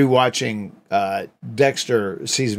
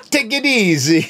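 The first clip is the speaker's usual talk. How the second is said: lightly voiced mob boss, higher; a hint of a New Jersey gangster; Tony Soprano voice; 10 semitones higher, 5 dB louder than usual. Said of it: "Sound like Tony Soprano".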